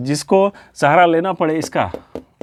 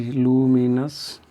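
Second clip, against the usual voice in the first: slow, dictated luminous; slow dictation; 2 semitones lower, 4 dB quieter, much slower than usual. Shown as a demonstration. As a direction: draw out luminous very slowly. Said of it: "luminous intense".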